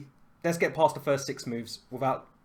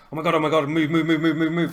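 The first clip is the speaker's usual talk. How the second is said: mock panic; 6 dB louder than usual. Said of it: "on my mouse going".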